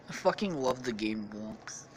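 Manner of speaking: plain reading voice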